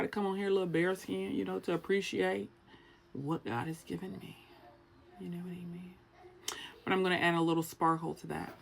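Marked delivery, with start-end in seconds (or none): 5.2-5.9 hushed, softer aside; whispering. Acted. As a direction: whisper it to the camera. Confiding aside.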